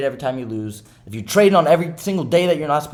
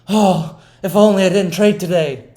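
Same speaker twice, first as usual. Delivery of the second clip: mock regret; 6 semitones higher, 6 dB louder than usual. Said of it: "And then you're sitting there".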